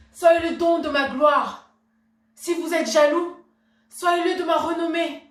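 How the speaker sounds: loud, high, ringing indignation; impassioned outburst. Acted. Wife urging her husband to get angry for her, impassioned outburst. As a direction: burst out and goad him hard.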